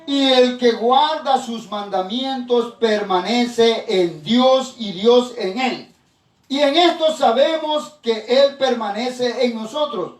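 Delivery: high, slow, ringing proclamation of a verse; preacher solemnly declaiming scripture, drawn-out words; scripture reading. Acted. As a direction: read the verse out loudly and deliberately. Reads scripture aloud to congregation.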